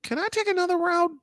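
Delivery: high, strained plea from inside; a frightened inner voice pleading to escape; panicked inner voice. Retold or imitated. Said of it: "everything inside of me lit up going".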